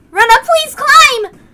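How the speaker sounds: high, slow, loud plea; high urgent plea. Acted. The group urges Rena up the ladder, high and pleading. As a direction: beg her to climb.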